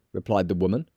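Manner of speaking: ordinary delivery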